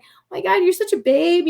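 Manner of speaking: retold gush, lifted high; gushing compliment. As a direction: imitate someone showering a friend with compliments. Retold gushing compliment from sweet woman.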